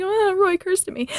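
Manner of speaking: high, soft mock-delighted fan voice. Imitating everyone who finds Roy's cursing hilarious, high mock delight. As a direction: imitate people tickled at being cursed at. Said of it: "everybody thinks it's hilarious".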